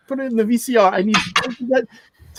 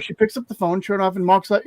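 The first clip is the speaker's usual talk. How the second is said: ordinary talk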